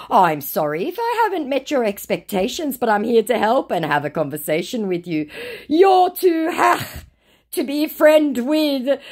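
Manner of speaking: high, hammed-up chatbot scammer; mock scammer voice. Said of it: "To which he returns".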